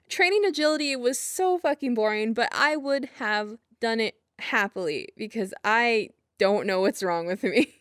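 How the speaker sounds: usual speaking voice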